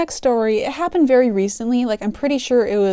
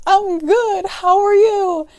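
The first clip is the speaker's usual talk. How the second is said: high, sing-out imitated call; calling across store; 9 semitones higher, slower than usual. Cashier answering customer across the store, high imitated call. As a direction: pitch it up to carry over distance. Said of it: "yelling it, like, across the store".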